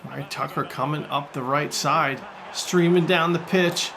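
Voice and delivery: ordinary talk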